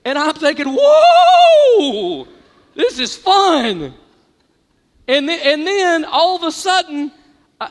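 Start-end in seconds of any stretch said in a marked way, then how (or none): 0.7-2.3 high, loud, long whoa; thrilled whoa. Retold or imitated. Thrilled on the wave. 2.7-3.9 slow delight. Retold or imitated. Thrilled on the wave.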